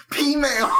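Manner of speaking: loud, high burst of delight; delighted shout. Real, not acted. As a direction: shout it as the joke lands. Realizing the pun, delighted.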